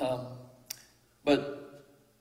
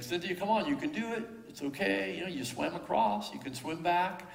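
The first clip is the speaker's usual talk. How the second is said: imitating a parent's encouraging call; 5 semitones higher than usual. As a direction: coax a scared child along, pitched up. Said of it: "trying to just encourage her".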